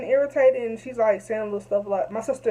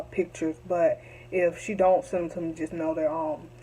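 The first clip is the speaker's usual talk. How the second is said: usual speaking voice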